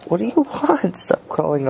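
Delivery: squeaky, sing-song question to a cat; high pet voice. Acted. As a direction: coo at the cat. Cat crawling on him.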